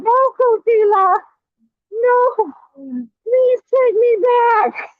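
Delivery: high falsetto begging, slow on the last words; mocking whiny plea. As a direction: mimic a pathetic ex begging to be taken back. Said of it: "You were saying".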